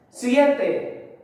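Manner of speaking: ordinary talk, nothing marked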